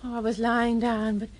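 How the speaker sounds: ordinary talk, nothing marked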